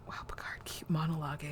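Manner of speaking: hushed awed whisper. Retold or imitated. Host whispering encouragement to Picard's monologue, awed whisper. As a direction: breathe it out in quiet awe.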